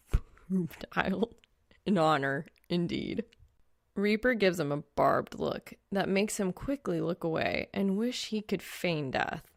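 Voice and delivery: ordinary delivery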